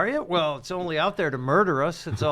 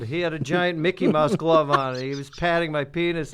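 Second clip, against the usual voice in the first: ordinary delivery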